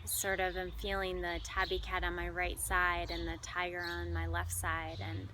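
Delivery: measured delivery with long, spacious pauses; guided meditation. Acted. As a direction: lead listeners gently through imagery, pausing between phrases. Leads animal imagery meditation.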